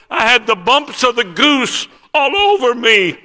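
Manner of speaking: high, loud, drawn-out exclamation; overwhelmed excitement. Retold or imitated. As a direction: cry out a friend's amazed testimony. Retold overwhelmed friend's testimony.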